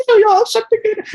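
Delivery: put-on very high, child-like squeal of make-believe; playful child's voice. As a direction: play it like a kid who believes the pretend game. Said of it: "any kid can step into that and be like".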